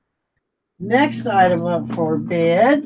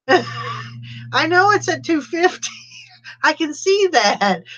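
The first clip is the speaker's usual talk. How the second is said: laughing; 7 semitones higher, slower than usual. Real, not acted. Laughing at bidding mix-up.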